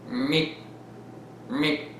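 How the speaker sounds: squeaky alien beep; tiny alien chirp; alien meep. Acted. Alien invasion skit.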